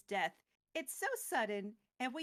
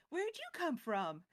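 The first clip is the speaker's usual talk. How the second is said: lightly stunned; 4 semitones higher than usual. Retold or imitated. Koth voiced, stunned.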